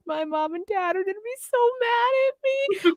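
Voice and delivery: put-on whiny scared teen. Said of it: "He's just like".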